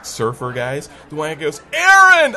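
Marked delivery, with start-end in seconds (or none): 1.7-2.3 put-on loud, high holler to an old buddy; imitated surfer's excited yell; calling out. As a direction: shout the name across the room. Surfer guy calls out to him.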